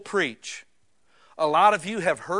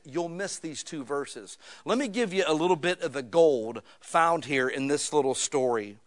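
usual speaking voice

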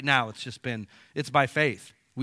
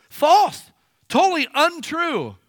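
much higher and louder, cutting denial; indignant outburst; 13 semitones higher, 10 dB louder, slower than usual. Real, not acted. Pastor defends his people against false accusations, indignant shout. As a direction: slam the lie down.

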